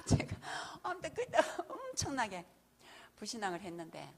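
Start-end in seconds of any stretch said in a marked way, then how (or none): none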